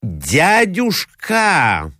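high, long-drawn exclamation; crying out. A narrator's reading. Mozglyakov interrupts his uncle, drawn-out cry. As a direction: stretch the word into an alarmed call. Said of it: "вскричал Мозгляков".

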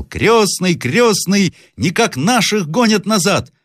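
put-on high, alarmed boyish cry; крик мальчика. A narrator's reading. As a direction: shout the bad news down to godfather. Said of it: "и мальчик закричал". (тревожный мальчишеский крик)

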